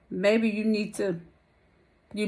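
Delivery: normal talking voice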